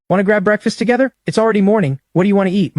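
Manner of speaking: voce alta e squillante da ragazza allegra; cheerful Bella. Acted. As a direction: una ragazza che invita con entusiasmo. Bella greets him cheerfully.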